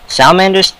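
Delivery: normal talking voice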